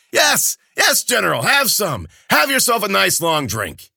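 loud, high bawdy joking; a tipsy old man ribbing his friend; booming high-pitched jester voice; jolly Kabata. A narrator's reading.

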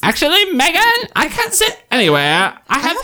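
high, mocking Valley girl voice; mock sassy girl. To friends, quoting a Vine in a high mocking voice. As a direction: squeak it like a snooty teen. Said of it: "did the Vine that was".

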